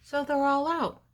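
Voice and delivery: excited reveal. A narrator's reading. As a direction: announce it like a big surprise. All animals escaped.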